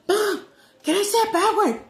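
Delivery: put-on high, loud little-girl shout. Retelling a TikTok girl running out to mom, high excited shout. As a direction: imitate a kid calling out to mom. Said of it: "then the little girl runs out, she said".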